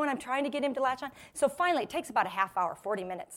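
ordinary talk

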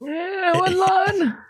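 put-on high, creaky old clerk; very old man. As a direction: croak it like a frail old man.

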